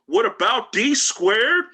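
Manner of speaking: yelled out high and loud across the classroom; mock yell from the back of the class; friend yelling. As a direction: heckle it from the back row, loud and slow. Friend yells out.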